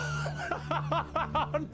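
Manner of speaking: high-pitched laughing fit. Real, not acted. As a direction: burst out laughing. Laughing after a blunder.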